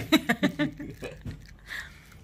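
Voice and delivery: high airy giggle; high breathy laugh. Real, not acted. Laughing after tease.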